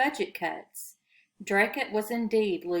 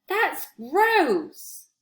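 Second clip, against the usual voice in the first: high, loud, grossed-out; disgusted; 9 semitones higher, 6 dB louder than usual. A narrator's reading. Paul looks at the mummy close-up, grossed out. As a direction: say it like it turns your stomach. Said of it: "he said, peering in".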